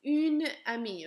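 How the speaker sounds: "une amie" with a clear U and N; une clearly. Shown as a demonstration. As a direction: say "une amie", article stressed. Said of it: "the pronunciation will change".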